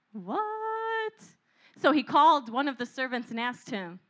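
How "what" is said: mock surprise. Feigned surprise at music.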